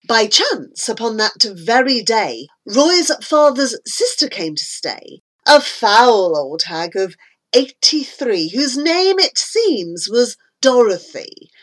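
plain reading voice

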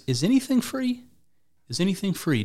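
high-pitched leading question; mock incredulity. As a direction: needle him with feigned wonder. Mockingly questioning Don about free vaccines.

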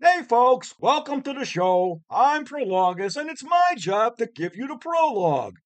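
high, loud, fed-up complaining; casual whiny actor voice. Acted. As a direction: whine it like a bored bit-part actor. Prologus drops act, casual complaining.

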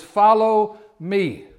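loud, very slow command; emphatic call. Shown as a demonstration. Jesus calling everyone to follow him, loud and slow. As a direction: ring out the call.

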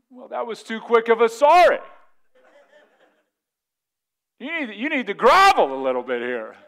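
loud, high mock indignation; indignant spouse. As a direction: play the offended spouse, loudly refusing the apology. Acted spouse rejecting quick apology.

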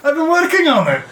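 high-pitched playful character voice; pleased character reply. Acted. Disguised impersonator answering praise, high playful voice. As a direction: take the compliment in a high voice.

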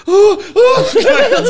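loud, high panicky gasps; mock terrified gasps. Hearing his bird on the stairs, high mock-frightened gasps. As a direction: gasp in hammed-up terror.

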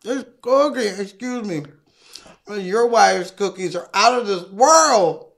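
moaning with pleasure over food; savouring neighbour. Acted. Neighbour praising the wife's cookies, savouring. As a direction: relish it with long mms.

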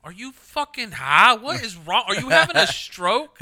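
high, loud, slow disbelieving shout; incredulous yell. Retold or imitated. Girlfriend yells at him over the spoon dip, loud disbelief. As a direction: yell at him like he's lost it.

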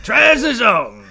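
darts MC shout. Pretend. Continuing MC shout.

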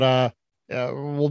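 ordinary delivery

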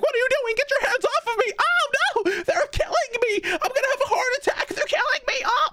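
put-on very high shrieking old woman voice; panicked old lady. Old lady being lifted onto a bed, high panicked shriek. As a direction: squeal like she's being murdered.

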